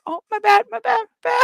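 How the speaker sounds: high, small spider voice backing away in apology; apologetic spider. Retold or imitated. A friend voices the spider who bit her apologizing. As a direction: play the bug saying sorry, squeaky and quick.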